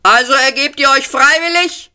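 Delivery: shouting policeman. A narrator's reading. Policeman yelling at the cornered group, loud. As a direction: call it out across the room. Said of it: "schrie wieder einer".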